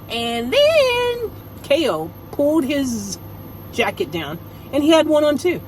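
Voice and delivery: ordinary talk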